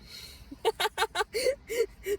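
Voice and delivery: high, loud burst of laughter; bitter laugh. Real, not acted. Laughs at own bad luck.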